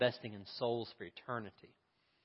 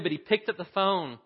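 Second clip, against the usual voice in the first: ordinary delivery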